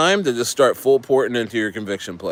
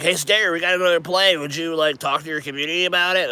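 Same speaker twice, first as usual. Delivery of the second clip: mocking the team; 4 semitones higher than usual.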